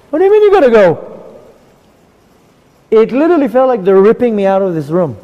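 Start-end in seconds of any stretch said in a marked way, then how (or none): 0.1-1.0 high, loud, disbelieving; shocked protest. Retold or imitated.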